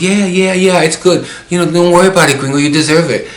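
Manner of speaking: high, animated friend voice; retold eager reassurance. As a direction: insist brightly that all is fine, imitating a friend. Voicing friend brushing off the worry.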